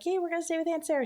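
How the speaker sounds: put-on high, rapid child-like chirp; quick high-pitched mock kids' cheer; excited kids. Kids happy to stay with aunt.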